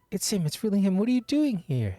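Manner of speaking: thrilled, rushing fan voice; starstruck. Acted. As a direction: gush like a fan meeting her idol. Fan spots the hero.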